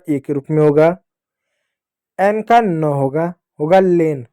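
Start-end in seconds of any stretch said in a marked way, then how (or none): none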